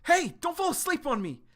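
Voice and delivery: high, loud plea to stay awake; urgent plea. Retold or imitated. Remy begging a fading friend, high and loud.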